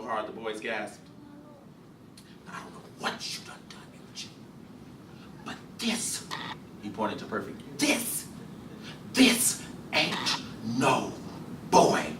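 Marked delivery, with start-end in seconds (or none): 2.5-12.1 soft, broken disbelief, almost a whisper; a father staring at his child, barely able to speak; stunned hush. Acted. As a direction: let the words fall out in shock. Gus stunned after slap.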